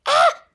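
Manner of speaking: short, high startled scream; sudden high "Ah!" scream. A narrator's reading. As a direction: let out a quick loud yelp of alarm. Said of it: "I screamed".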